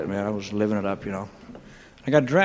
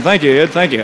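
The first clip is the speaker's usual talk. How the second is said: bright, eager thank-you, a bit louder; 3 semitones higher, 6 dB louder than usual. Retold or imitated. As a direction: gush your thanks to Ed. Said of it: "so I'm saying".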